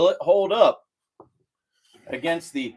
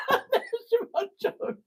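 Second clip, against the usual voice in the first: cracking up at own joke; laughing hard; much slower than usual. Real, not acted. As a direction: squeal with laughter.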